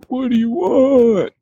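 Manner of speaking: slow, high, sleepy grumble; a woken teenager, annoyed; imitating a half-asleep kid; groggy teen.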